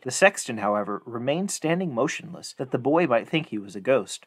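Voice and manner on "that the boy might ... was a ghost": ordinary delivery, nothing marked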